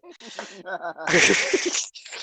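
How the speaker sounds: real high, loud laughter. Real, not acted. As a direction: crack up loudly at a high pitch. Laughter after best pick claim.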